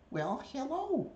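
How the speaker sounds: high, slow, drawn-out greeting; pet-talk voice. Real, not acted. Owner greeting a reluctant bird, high slow pet-talk. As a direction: coo it high and stretched out to a pet.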